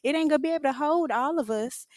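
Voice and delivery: high, squeaky mock panic about the bench; mocking the girls. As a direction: shriek it like a diva. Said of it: "and they like".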